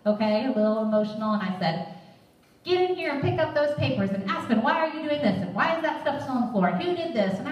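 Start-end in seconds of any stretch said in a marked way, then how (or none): 2.6-7.4 put-on high-pitched scolding of the kids; fed-up mom scolding; a mild retold rant at the kids; mini rant. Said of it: "I started on a little mini rant".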